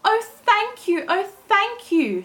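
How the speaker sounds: high, loud grateful cry; tiny girl calling out thanks. Acted. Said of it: "she called".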